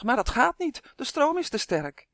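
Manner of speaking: acted, exasperated half-shout; shouting back. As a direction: call back in urgent protest. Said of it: "schreeuwde Justin terug".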